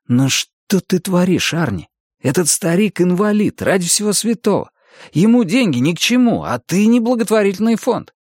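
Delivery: high-pitched, urgent protest; exasperated remonstrance. A narrator's reading. Dennis arguing Arnie out of paying the old man, exasperated.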